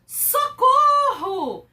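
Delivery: high, loud, slow scream; panicked cry for help. Shown as a demonstration. Teacher acting out fear for the class, high and loud. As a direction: cry out in terror. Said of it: "ou medo, desespero".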